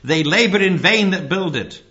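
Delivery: booming sermon delivery; thundering. Retold or imitated. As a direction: thunder the scripture out. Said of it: "he then thundered the text".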